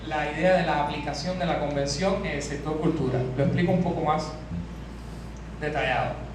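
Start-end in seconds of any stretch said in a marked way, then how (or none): none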